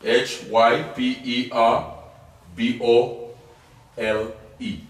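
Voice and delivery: slow letter-by-letter spelling. Shown as a demonstration. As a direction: spell it out one letter at a time, slowly. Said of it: "spells the word for class".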